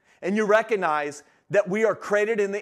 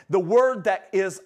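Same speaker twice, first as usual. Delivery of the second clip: ordinary talk, nothing marked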